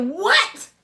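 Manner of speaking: high-pitched astonished "what"; shocked. Retold or imitated. Cousin reveals the boy's secret, shocked reply. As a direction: retell a stunned reaction. Reacting to retold shocking news.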